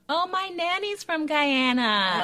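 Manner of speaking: high, slow, sing-song gush; mock gushing. Imitating people who mention their nannies, mock gushing. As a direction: gush cluelessly, stretching it out. Mimicking gushing remarks she gets.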